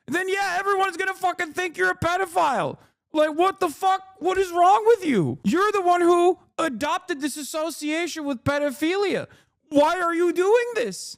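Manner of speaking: high-pitched incredulous rant; exasperated outrage. Real, not acted. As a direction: let exasperation push the voice way up.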